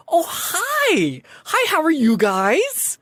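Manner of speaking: high, bright-eyed chirpy welcome; perky sphinx greeter. Acted. Sphinx greeter welcoming the cosplayers, high and chirpy. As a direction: greet them like an eager event host. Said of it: "she looks up at you and goes".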